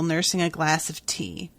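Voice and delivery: ordinary delivery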